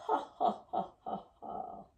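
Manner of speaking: string of breathy ha's, fading out; staccato exhaled ha, tailing away; puffed ha breaths. Shown as a demonstration. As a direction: puff out ha in short pulses.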